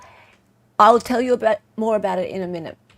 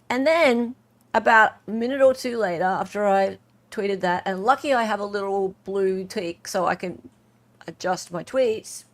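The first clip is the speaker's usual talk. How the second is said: normal talking voice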